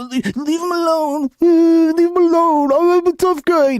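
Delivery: high, trembling mock tough guy; mocking scared protester. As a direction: play him as a shaky boy faking toughness. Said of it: "he's shaking like a little".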